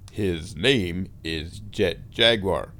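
low, slow, deliberate dub voice; dubbed movie Goro. Retold or imitated. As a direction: imitate a badly dubbed man, word by word. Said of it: "And Goro says".

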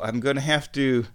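ordinary talk, nothing marked

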